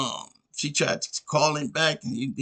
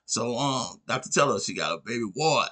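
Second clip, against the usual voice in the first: ordinary delivery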